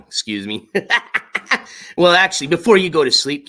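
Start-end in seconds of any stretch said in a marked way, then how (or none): 2.0-3.5 sudden loud, back to full voice; bursting out of the hush; loud again. Shown as a demonstration. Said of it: "I just woke you up".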